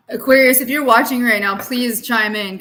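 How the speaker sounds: loud call to Aquarius. Real, not acted. Calling to Aquarius viewers, loud. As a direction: call out to get their attention.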